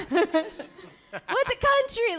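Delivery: high, drawn-out comedian impression; Yakov Smirnoff. Comedian showing off a best impression, high and slow. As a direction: stretch the words out slowly, pitched high. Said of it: "that's my best impression".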